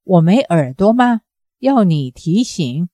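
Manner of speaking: plain reading voice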